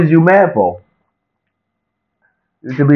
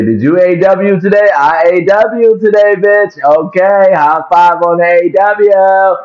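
excited; 6 semitones higher, 4 dB louder than usual. Acted. Hyping up the A W greeting.

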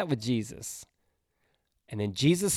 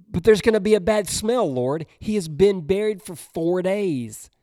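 higher, louder worried objection; alarmed protest; 5 semitones higher, 6 dB louder than usual. Acted. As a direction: push back at Jesus, alarmed. Martha protests to Jesus.

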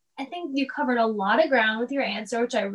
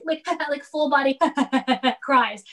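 ordinary talk

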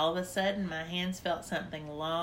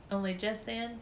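usual speaking voice

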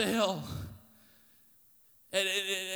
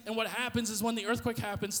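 ordinary talk

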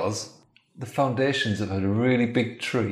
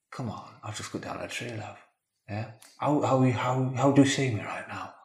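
seductive murmur; 2 semitones higher, 6 dB quieter than usual. Acted. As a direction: try to charm her into bed. Husband seducing wife.

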